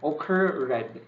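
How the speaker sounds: occur red split into occur plus RED; spelling memory pronunciation. Shown as a demonstration. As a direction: say occur red as two distinct words. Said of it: "I just say that".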